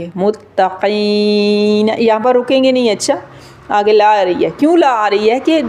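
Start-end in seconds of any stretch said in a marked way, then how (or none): 0.1-1.9 slow "متقین" with heavy ق and held ee; thick qaaf, long yaa. Shown as a demonstration. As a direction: make ق full in "متقین" and stretch the following vowel. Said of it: "موٹا بھی پڑھیں گے اور تھوڑا سا".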